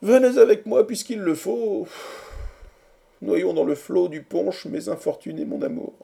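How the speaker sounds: high lament sinking into a hushed end; despairing. A narrator's reading. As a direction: give in loudly, then let the despair fade away. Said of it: "dit Quatercam désespéré". (désespoir aigu qui retombe)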